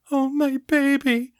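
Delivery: put-on high tearful cry over her child. A narrator's reading. Mother hugging her lost son, tearful cry. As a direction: weep the words as you hold him. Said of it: "Margaret cried".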